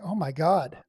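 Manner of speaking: loud amazed exclamation. Real, not acted. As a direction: burst out in delight. Amazed by a photo.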